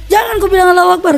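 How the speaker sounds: fast, high-pitched rebuke; retold angry scolding. Father forbidding his child's cry, imitated angry scolding.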